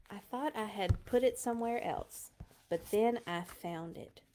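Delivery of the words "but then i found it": usual speaking voice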